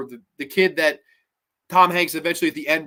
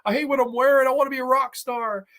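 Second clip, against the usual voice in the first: mock whine, pitched up; miserable whining; 8 semitones higher than usual. Son complaining to Mom about dentistry, mock miserable whine. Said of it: "he's miserable".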